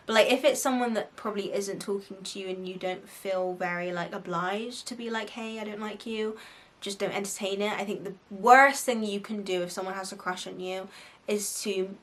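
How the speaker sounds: ordinary talk, nothing marked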